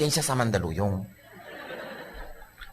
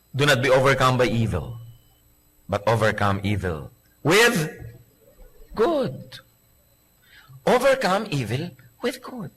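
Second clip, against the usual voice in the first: slow, soft recitation of the verse; scripture reading; 3 semitones lower, 3 dB quieter than usual. Acted. Preacher reading Romans slowly to the congregation. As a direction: let the verse land slowly.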